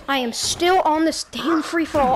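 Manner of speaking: ordinary talk, nothing marked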